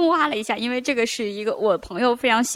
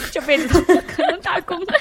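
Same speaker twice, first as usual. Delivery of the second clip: quoted through laughter, pitched up; laughing; 7 semitones higher, 4 dB louder than usual. Retold or imitated. Guest laughing as she quotes the no-work meme. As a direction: crack up while finishing the meme line.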